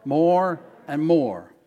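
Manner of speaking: slow, loud, spaced-out words; drawn-out, emphatic prompt to repeat; leading a call-out. Shown as a demonstration. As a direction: lead the congregation in saying it together. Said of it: "Someone say more and more with me".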